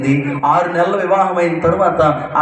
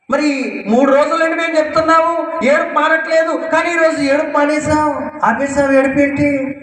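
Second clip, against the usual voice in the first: much higher, pressing amazed questions; astonished questioning; 10 semitones higher than usual. Retold or imitated. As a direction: townsfolk demanding to know, high and eager. People ask widow why she stopped. (ఆశ్చర్యంతో పైస్థాయిలో అడగడం)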